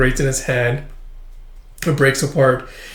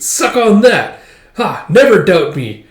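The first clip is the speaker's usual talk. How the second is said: loud, high triumphant taunt; gloating boast; 6 semitones higher, 6 dB louder, slower than usual. Retold or imitated. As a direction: rub the victory in. Frankie gloats to the teller.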